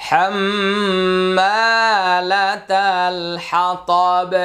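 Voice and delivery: slow melodic tajweed chant; Quran recitation. Shown as a demonstration. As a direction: recite it slowly with a long nasal hold. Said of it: "এটা সূরাতুল লাহাবে". (ধীরে সুরেলা তিলাওয়াত)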